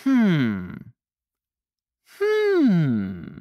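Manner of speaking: usual speaking voice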